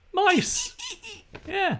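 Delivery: piercing squeak, very high; mouse impression. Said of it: "That was my mouse impression".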